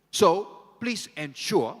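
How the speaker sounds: high, loud and slow, pitched to carry across the room; calling out to the hall. Real, not acted. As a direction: raise your voice across the crowd.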